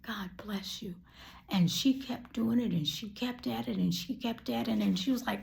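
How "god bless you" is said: breathy whisper, much softer; whispered blessing. Retold or imitated. Whispering a blessing over the kind lady. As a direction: murmur a blessing in awe.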